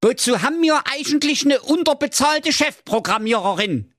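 very high-pitched comic rant; angry yell. Acted. Jakob demands his chief programmer, high comic rage. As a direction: rant in a squeaky, outraged character voice. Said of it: "brüllte der Jakob Grimm wütend".

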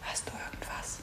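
soft, tense whisper. Acted. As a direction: keep it hushed while listening. Hiding from possible intruder.

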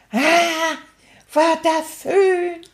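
high, slow dreamy sigh of contentment; a happy toy sighing at home; blissful sigh. Real, not acted. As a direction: melt into the memory, slowly. Blissful sigh about coming home.

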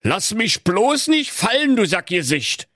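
high-pitched, panicky plea; frightened old grouch. A narrator's reading. Old miser flying with the dwarf, frightened. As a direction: plead and scold in fright. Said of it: "mit ängstlicher Stimme".

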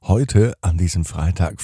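ordinary delivery

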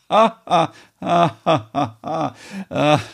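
high, nervous giggling; flustered giggle; embarrassed laugh. Acted. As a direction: laugh off the awkward moment.

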